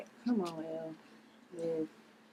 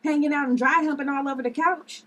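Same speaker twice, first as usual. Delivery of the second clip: loud and higher, mocking disbelief; sarcastic shout; 7 semitones higher, 8 dB louder than usual. Liv mocking her sister's excuse, loud sarcastic jab. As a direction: throw her excuse back at her.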